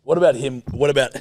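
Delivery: ordinary talk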